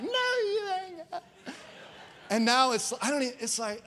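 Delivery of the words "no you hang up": much higher, slow, whiny sweet falsetto; squeaky baby voice. Retold or imitated. Imitating his wife's lovey phone voice, squeaky. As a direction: squeal it like a lovesick teen. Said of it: "she's like".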